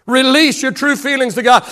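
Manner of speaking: full-voiced pulpit delivery; preached repeat. Real, not acted. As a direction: lift it up and preach it out. Preached repeat of the soft line.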